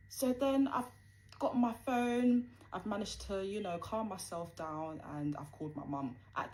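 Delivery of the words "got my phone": usual speaking voice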